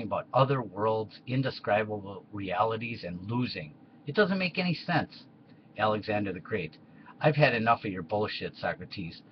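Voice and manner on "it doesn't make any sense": exasperated squeak. Acted. Alexander exasperated with philosophers.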